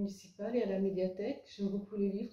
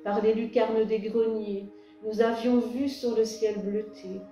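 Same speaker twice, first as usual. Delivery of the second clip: louder, projected poem recitation with measured pauses; poem reading; 5 dB louder than usual. Acted. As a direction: read your poem aloud, line by line, lifting the refrains. Reads own poem to audience. (lecture de poème à voix haute, posée et projetée)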